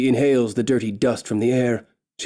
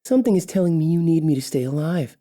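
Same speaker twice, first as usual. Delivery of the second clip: higher, sly and knowing; smug elf taunt; 6 semitones higher, faster than usual. Acted. Elf taunting the orc king, higher and smug. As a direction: pitch it up, sly confidence.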